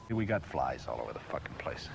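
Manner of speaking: low, voiceless grumble under his breath; whispered complaint about flies; muttered whisper. Real, not acted. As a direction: hiss it to yourself.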